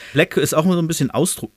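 ordinary delivery, nothing marked

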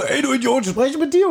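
spöttisch nachgemachter Ruf, deutlich höher; pöbelt den Typen am Bahnsteig gespielt an; nachgemachtes Anpöbeln. Retold or imitated. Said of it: "So wie du gerade gesagt hast". (den Freund nachäffen, wie er einen Fremden anpöbelt, hoch und spöttisch)

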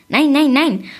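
high, loud triple no; emphatic refusal. A narrator's reading. Little man rejects the queen's guess, emphatic and high.

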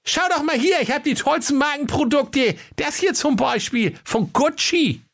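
very high sales-pitch voice; eager shopkeeper. Acted. Höke presents brands to Kaiser, high and eager. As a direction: show off the goods in a high comic voice.

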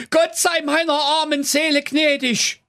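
high, desperate panicked plea; terrified shout. Acted. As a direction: beg for mercy in terror. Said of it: "schrie, so laut er nur konnte". (verzweifelter Angstschrei)

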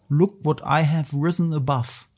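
usual speaking voice

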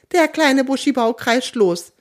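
ordinary delivery, nothing marked

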